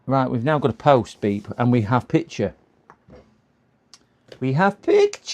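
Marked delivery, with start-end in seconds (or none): 4.4-5.3 higher and louder, a real little cheer; thrilled announcement of success; excited. Real, not acted. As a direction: celebrate the picture finally coming up. Picture finally appears after waiting.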